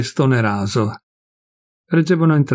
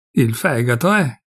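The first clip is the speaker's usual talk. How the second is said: ordinary delivery, nothing marked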